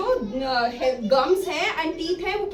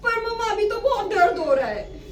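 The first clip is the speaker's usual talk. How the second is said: put-on whiny child; 5 semitones higher, 4 dB louder than usual. Child whining in story.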